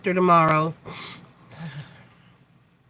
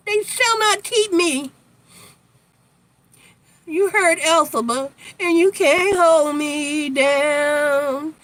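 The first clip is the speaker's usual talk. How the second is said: a light Broadway diva defiance, higher; theatrical defiance; 5 semitones higher, slower than usual. Acted. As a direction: declaim it like a stage witch. Quoting Elphaba defiantly.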